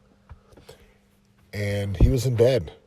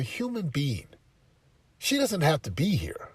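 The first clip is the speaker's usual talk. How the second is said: firm lecture to son; 6 semitones higher, 3 dB louder than usual. Retold or imitated. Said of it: "I said".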